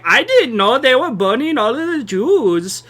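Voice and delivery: exaggerated sing-song German accent; mock a German official denying everything. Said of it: "That's my German accent".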